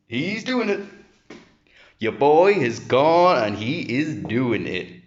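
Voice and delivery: high, loud, slow hype call; hyped commentator. Acted. Gym lifter hyping himself up to his camera, slow and loud. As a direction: call it like a ringside announcer.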